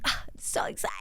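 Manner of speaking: hushed, whispered squeal of excitement; excited. Retold or imitated. Retelling her New Year morning, whispered excitement. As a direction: squeal it under your breath.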